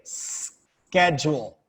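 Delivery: "schedule" said slowly as SKED-jool, stress on the first syllable; model pronunciation. Shown as a demonstration. Teacher modelling a word for the class, slow and clear. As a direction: stretch out "schedule" for students to repeat. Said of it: "let's say it".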